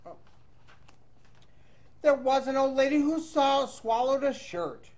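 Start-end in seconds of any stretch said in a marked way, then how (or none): none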